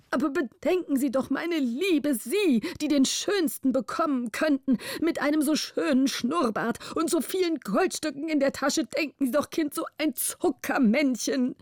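hoch, leicht weinerlich bittend; fast weinerlich. A narrator's reading. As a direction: ein Hauch von Weinerlichkeit. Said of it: "sagte er fast weinerlich". (fast weinerlich flehend)

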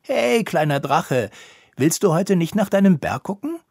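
high, loud cheerful call to a little dragon. A narrator's reading. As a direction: call over to the little one, pitch climbing to the question. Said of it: "rief Drago zu".

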